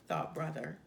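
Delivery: plain reading voice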